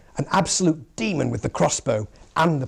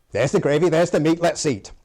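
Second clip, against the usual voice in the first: put-on quick, loud Preston accent; higher, punchy Preston voice; Preston grace; 4 semitones higher, 6 dB louder than usual. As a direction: rattle off a blunt Preston grace. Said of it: "In Preston, they are regularly reputed".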